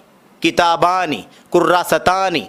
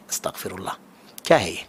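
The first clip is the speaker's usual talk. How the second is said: much softer, low, disbelieving astaghfirullah; hushed dismay; 11 semitones lower, 9 dB quieter than usual. Real, not acted. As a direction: drop the voice in pained disbelief. Dismayed at the mistranslation. (بہت دھیمی آواز میں افسوس سے استغفر اللہ)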